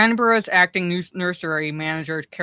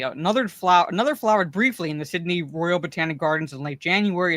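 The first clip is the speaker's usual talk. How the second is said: usual speaking voice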